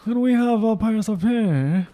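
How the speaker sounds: put-on high, slow posh British child; posh English accent. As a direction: imitate a posh English kid addressing Father. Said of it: "posh accents".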